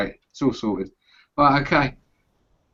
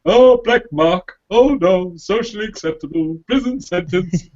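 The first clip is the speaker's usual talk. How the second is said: mock alarm; 5 semitones higher, 3 dB louder than usual. Mocking PC alarm.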